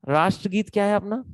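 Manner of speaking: ordinary talk, nothing marked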